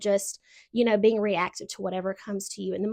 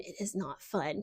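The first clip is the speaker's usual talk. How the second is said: dropped to near silence; softer repeat; 4 semitones lower, 10 dB quieter than usual. Shown as a demonstration. Speaking to viewers about discomfort, repeating it softly. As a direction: let the repeat fade right down.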